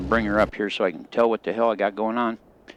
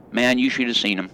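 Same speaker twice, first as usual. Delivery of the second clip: usual speaking voice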